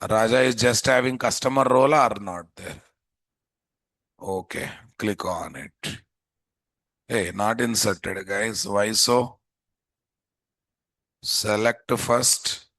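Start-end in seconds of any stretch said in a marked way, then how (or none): none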